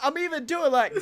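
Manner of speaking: ordinary talk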